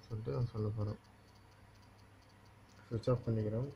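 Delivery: normal talking voice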